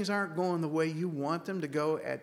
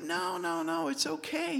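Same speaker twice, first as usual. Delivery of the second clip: much higher voice, retold hasty soothing; high-pitched reassurance; 13 semitones higher than usual. Customer insisting to the upset manager it's okay, high-pitched reassurance. Laughing retelling of calming the manager.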